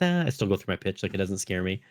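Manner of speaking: ordinary talk, nothing marked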